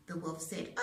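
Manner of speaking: plain reading voice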